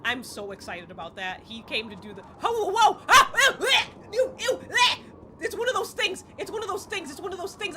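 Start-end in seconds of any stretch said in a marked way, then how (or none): 2.2-3.0 high, loud startled whoa; startled yelps. Real, not acted. Monster appears in game. 3.1-5.0 high, loud squeals of disgust; disgusted shrieks. Real, not acted. Gross monster chasing her. 5.4-7.8 high loud panic settling down; panicked squeal fading. Real, not acted. Gross monster chasing her.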